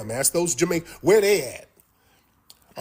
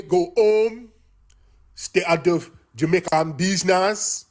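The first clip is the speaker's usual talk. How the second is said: a rough, hammed-up Jamaican accent, pitched higher; bad Jamaican accent; 4 semitones higher than usual. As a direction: play a Jamaican telling Yankees to go home, badly faked.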